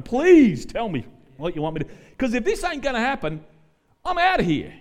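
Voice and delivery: loud pleading ultimatum. Retold or imitated.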